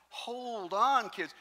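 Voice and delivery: high, loud mock "hold on"; calling out to the kids, half joking; 1 mock call to stop. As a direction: pastor calling out to gorging kids, mock alarmed. Pastor stops kids stuffing pockets.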